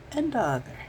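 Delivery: usual speaking voice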